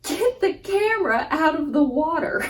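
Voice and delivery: high yelled order; dad yelling. Retold or imitated. Dad shouts to save the camera from the river, high yell. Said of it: "And my dad goes".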